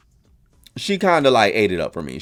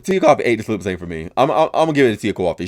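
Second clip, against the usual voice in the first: ordinary talk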